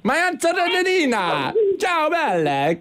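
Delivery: high, slow mock Italian voice; Italian accent. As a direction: play a hammed-up Italian aunt. Mock Italian aunt after Italy question.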